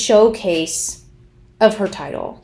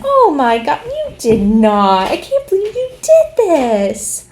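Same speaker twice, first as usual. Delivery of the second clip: loud, drawn-out mock amazement; feigned surprise; 6 semitones higher, 4 dB louder, slower than usual. Mimicking someone thanking their husband for the party, fake astonishment. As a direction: overdo the astonished thanks, stretching words out. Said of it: "they look at their husband".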